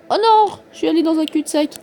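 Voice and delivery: high, loud cry, then quick words; dismayed outcry. Real, not acted. Player drives into a dead end, dismayed outcry. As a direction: yelp in dismay and rush on.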